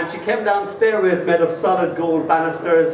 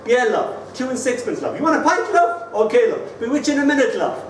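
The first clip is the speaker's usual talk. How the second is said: a lighter, higher voice of friendly Irish pub talk; Irish barman chatter; 8 semitones higher than usual. Retold or imitated. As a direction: toss off each love breezily like a barman. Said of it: "But you all say".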